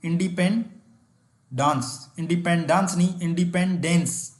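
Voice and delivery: Independence drawn out syllable by syllable; independence stretched for a class; very slow. Shown as a demonstration. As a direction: spell it with your voice, very slowly. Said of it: "ये देखो".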